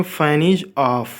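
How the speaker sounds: each word drawn out very slowly; teacher spacing out Fan is on; slow model sentence. Shown as a demonstration. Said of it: "हम उसे कैसे बोलेंगे".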